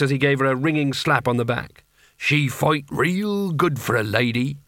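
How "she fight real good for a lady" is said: slow, admiring captain; ship's captain. A narrator's reading. Captain praises Kanina after slapping her back, slow admiring voice. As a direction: relish the praise as a rough-spoken captain.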